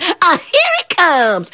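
put-on high, slow, dreading girl frog; tiny frog girl sighing at what's coming. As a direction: squeak it out with dread. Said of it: "The girl frog said".

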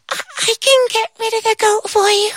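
very high tiny voice; tiny ant voice. Acted. Tiny ant offering help, very high squeak.

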